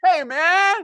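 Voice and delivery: loud, very high comic outcry; high-pitched protest. Acted. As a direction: wail it way up high. Acted bar patron's protest.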